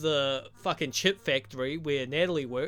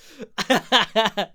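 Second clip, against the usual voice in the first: high loud cackle at his own joke; loud amused laugh; burst of laughter; 6 semitones higher, 9 dB louder than usual. Real, not acted. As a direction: break into a laugh. Laughs at his stereotype remark.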